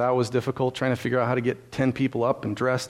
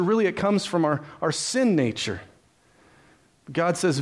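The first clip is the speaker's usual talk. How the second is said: ordinary talk, nothing marked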